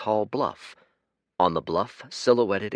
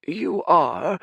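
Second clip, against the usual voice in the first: higher, louder, startled question; puzzled old man; 10 semitones higher, 7 dB louder than usual. Acted. Old Fate surprised by the red giant's name, startled question. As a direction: catch the surprise.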